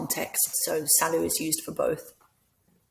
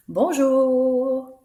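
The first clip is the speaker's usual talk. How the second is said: high, loud, drawn-out hello; happy; 6 semitones higher, 7 dB louder than usual. Shown as a demonstration. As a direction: greet brightly as if just arriving, pitched up and loud. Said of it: "happy and sad".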